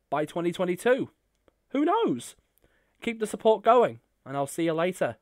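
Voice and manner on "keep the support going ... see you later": ordinary talk, nothing marked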